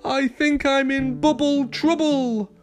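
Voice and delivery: high, worried pup whimper. A narrator's reading. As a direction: whimper it on a high held pitch. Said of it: "Rubble whimpered".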